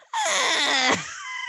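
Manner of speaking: high baby wail, mimicked; shrill-pitched mock infant cry; imitated baby cry. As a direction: wail like a baby just put down. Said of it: "they're just like".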